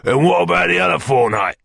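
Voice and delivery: high, pressing demand from a rough villain. A narrator's reading. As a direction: demand an answer, pitched well up. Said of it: "demanded Sikes".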